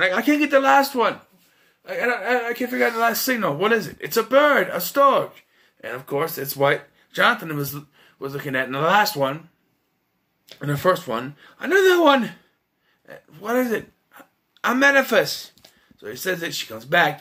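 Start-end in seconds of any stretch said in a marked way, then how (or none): 0.0-5.4 put-on higher, stammering, fast child's voice; lightly voiced boy stuck on the last glyph; struggling kid. Kid struggling to read spell. 11.6-15.5 much higher and louder, triumphant; boy reading the spell breaks through with delight; excited kid. Retold or imitated. Kid finding the last word.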